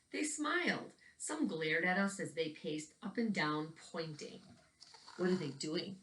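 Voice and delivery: put-on gentle storytelling read for young listeners; picture book narration. A narrator's reading. Teacher reading a picture book to children, storytelling voice. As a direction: read the book aloud to the kids, evenly paced.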